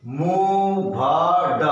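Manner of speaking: very drawn out; sounded out slowly; slow syllable split. Shown as a demonstration. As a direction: stretch the parts apart. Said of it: "slow split demo".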